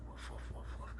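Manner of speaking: mock barking, whispered; whispered dog imitation; whispered woofs. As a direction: breathe out little dog barks.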